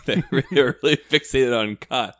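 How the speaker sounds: laughing while talking, slow and high. Real, not acted. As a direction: laugh through the words. Laughing at the title list.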